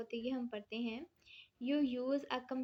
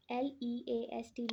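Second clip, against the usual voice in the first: slow letter-by-letter spelling. Shown as a demonstration. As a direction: dictate each letter slowly. Teacher spelling out a word.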